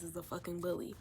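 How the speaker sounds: a hushed, breathy whisper; whispered curse. Retold or imitated. Whispering the insult to the roommate.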